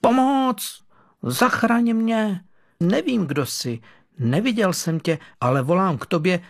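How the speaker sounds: high pleading call fading to a plea; desperate cry for help. Acted. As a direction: cry out with everything, then sink. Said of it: "aby začal volat". (list zoufale volá o pomoc, nejdřív hlasitě, pak prosebně)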